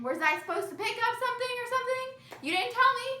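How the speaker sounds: high mock-innocent dad voice; imitating dad. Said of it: "And he's like".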